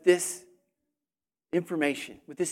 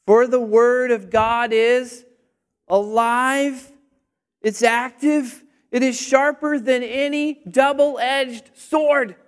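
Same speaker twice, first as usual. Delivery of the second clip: slow, loud, emphatic verse reading; scripture proclamation; 4 semitones higher, 5 dB louder, slower than usual. Real, not acted. Preacher declaims Hebrews to congregation, loud and slow. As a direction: declaim each phrase with weighty force.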